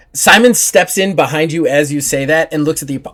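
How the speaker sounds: normal talking voice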